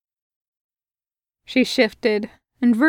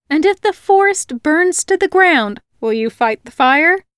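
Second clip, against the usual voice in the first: higher, louder teasing retort; 5 semitones higher, 4 dB louder than usual. A narrator's reading. Greta teasing Virgil with a louder, higher retort. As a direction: throw the question right back at him. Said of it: "she retorted".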